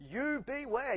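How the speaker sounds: "You" punched high and loud, then "beware" falls; "You" rises sharply and lands loud, "beware" drops away; stress on YOU. Acted. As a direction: hit the word "You" hard before "beware". Warning repeated, YOU stressed.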